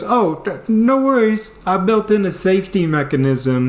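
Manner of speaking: high, breezy mock reassurance; brother chiming in. Brother reassuring the sleep-screamer, high and breezy. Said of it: "my brother chimes up".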